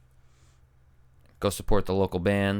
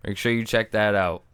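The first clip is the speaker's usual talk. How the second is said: ordinary delivery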